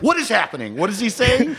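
high-pitched imitation of confused bystanders; baffled listeners. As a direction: ask it like you can't follow a word. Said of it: "and everyone's like".

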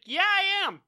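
high, loud mock insistence; imitated shouted retort; insisting. Retold shouted argument with ship.

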